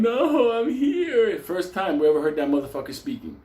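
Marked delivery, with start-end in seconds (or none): 0.0-1.4 high-pitched wailed protest; mock crying. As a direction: imitate a man crying out. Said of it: "Then he's crying".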